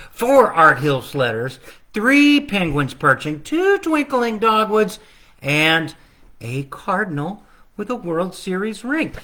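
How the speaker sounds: sing-song counting rhythm, each number leaned on; carol countdown. Acted. Illustrator reading his book's countdown in sing-song. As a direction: recite it like the carol.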